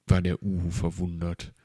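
ordinary delivery

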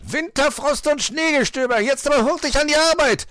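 high, full-force fox yell; angry shouting. A narrator's reading. Fox screams at girl to work, enraged shouting. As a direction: bellow it as a furious order. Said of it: "schrie er sie an".

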